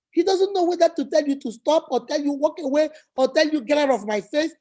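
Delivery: usual speaking voice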